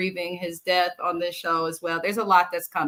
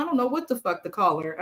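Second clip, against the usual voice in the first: usual speaking voice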